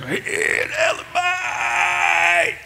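high, rasping screech with a long drawn-out end; raw crow-like cry. Shown as a demonstration. Showing how the beggar's cry must have sounded, raw cawing shriek. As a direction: croak it out like a cawing raven. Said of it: "met zo'n rauwe stem".

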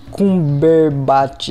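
Cumberbatch sounded out slowly, syllable by syllable; careful name reading. Real, not acted. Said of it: "eu vou tentar ler o nome". (lendo Cumberbatch devagar, sílaba por sílaba)

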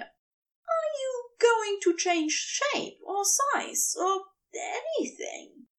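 an angel uneasy before the serpent; high, slow and hesitant; nervous angel. A narrator's reading. Aziraphale asks nervously.

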